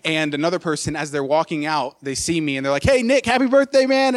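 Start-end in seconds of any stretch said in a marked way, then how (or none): 2.8-4.2 imitated loud, much higher call across the lobby. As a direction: hail him cheerfully from a distance. Stranger calls out in lobby.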